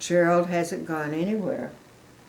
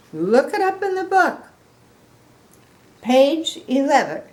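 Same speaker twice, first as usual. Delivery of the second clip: much higher, loud, insistent elephant voice; Gerald the elephant; 7 semitones higher, 6 dB louder than usual. Acted. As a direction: point her firmly to the book. Gerald's voice in story.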